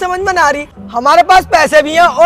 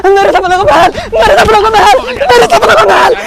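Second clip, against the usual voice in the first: high, climbing frantic cries; hysterical wailing; 6 semitones higher, 6 dB louder than usual. Acted. Man kept from his dream palace, hysterical. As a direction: wail it over and over, frantic.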